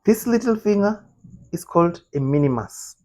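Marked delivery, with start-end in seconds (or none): 0.0-1.0 amazed. Real, not acted. Repeats the fact in disbelief.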